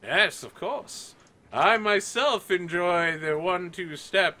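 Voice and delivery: high, slow, self-satisfied nobleman; self-important knight. Acted. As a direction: show off slowly like a pompous dancer. GM voices Sir Donovan showing off.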